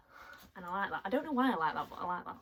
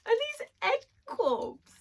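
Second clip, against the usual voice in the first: surprised question; 7 dB louder, much slower than usual. Real, not acted. Surprised at box contents.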